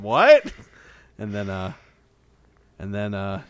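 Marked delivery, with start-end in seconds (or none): 0.0-0.5 loud high shocked What; shocked shout. Retold or imitated. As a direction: burst out in disbelief. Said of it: "And I said".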